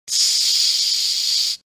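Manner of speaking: ち as a long voiceless hiss with no vowel; draught-like hiss. Shown as a demonstration. Teacher isolating the chi consonant, long draught-like hiss. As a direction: stretch the consonant of ち like wind through a gap. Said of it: "隙間風のような音が少し出ます".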